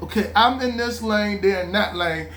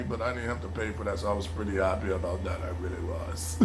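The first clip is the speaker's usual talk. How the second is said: quiet aside; 13 semitones lower, 10 dB quieter, faster than usual. Real, not acted. Fading satisfied aside.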